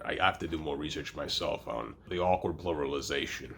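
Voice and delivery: normal talking voice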